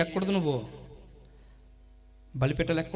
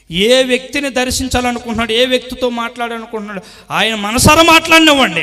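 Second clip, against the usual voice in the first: loud, higher preacher's cry; preaching at full force; 6 semitones higher, 12 dB louder than usual. Acted. Preacher thundering to the congregation, full force. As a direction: drive each line home to the church.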